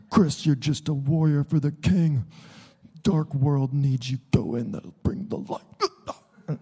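slow, hushed strained voice, fading out; Mark Pegley impression. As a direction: mimic a friend's intense charge to a warrior. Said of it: "He talks like that".

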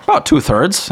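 high, loud deadpan dwarf quip; wry dwarf. Acted. Dwarf jokes about his height, high and loud. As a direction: toss off the joke as the dwarf.

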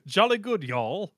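hammed-up Brit turning cowboy; jolly English gent slipping into Texan; British with a twang. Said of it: "imagining Speedwagon saying".

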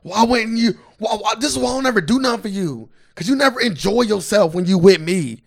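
put-on high, heated girlfriend rant; going off on him. Girlfriend tearing into boyfriend after the show, imitated angry rant. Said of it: "His girl went off on him".

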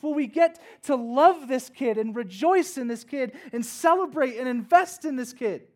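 loud, high, driving sermon build; full-force preaching. Acted. Pastor tells congregation his son's story, loud and fervent. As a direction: preach it with rising fervor.

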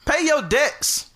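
high, slow, loud demand; loud call-out. Acted. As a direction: call it out slowly across the room. Calling out debtor.

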